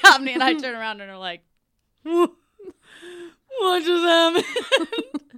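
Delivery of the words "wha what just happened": slow, loud, laughing what just happened; stunned laughing disbelief. Retold or imitated. Friends after door closes.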